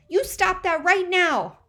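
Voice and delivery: high, loud, slow scolding; little sister yelling a command; scolding shout. A narrator's reading. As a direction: order him to stop at the top of her voice. Teresa scolds Moose.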